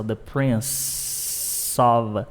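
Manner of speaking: "Prince" with a long drawn S before "of"; hissed S ending. Shown as a demonstration. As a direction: stretch the final S of "Prince" slowly. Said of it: "tem um som de S".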